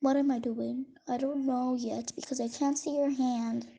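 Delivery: ordinary talk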